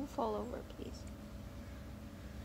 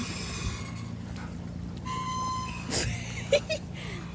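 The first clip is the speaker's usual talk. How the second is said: high squealing laugh; 7 dB quieter than usual. Real, not acted. Delighted squeal.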